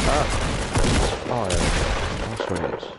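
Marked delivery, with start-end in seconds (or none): 0.1-1.3 sharp high startled gasp; startled yelp. Real, not acted. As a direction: jolt at a sudden enemy. Suddenly spots hidden enemy.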